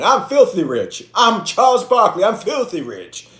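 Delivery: loud, high imitated boasting; mock bragging shout; Charles Barkley. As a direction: crow it across the blackjack table. Imitates Barkley bragging while gambling.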